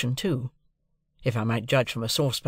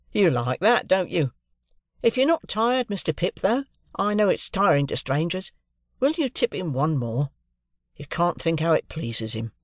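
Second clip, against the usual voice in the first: a touch higher, friendly clerk coaxing a guest; lightly voiced clerk; 5 semitones higher than usual. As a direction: lightly play the fond son asking a favour. Wemmick speaks to Pip.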